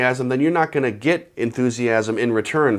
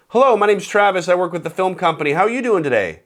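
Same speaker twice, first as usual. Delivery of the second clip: quick enthusiastic intro; 6 semitones higher than usual. Shown as a demonstration. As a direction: get to the point with energy. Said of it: "You gotta get to the point quick".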